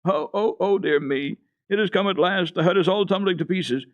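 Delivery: startled grandmother fearing the hut is falling, high alarmed cry; frightened cry. A narrator's reading. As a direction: cry out high as if the house is collapsing. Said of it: "trembled, crying out".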